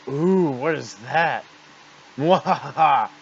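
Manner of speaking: slow, loud villain with evil laugh; slow theatrical villain menace. Acted. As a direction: gloat slowly like a cartoon villain. Boss Swalot voiced in cutscene.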